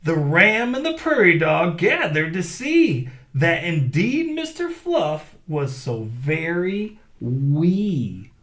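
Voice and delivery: lively read-aloud storytelling; story narration. A narrator's reading. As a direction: read it big for the kids. Reads story to children.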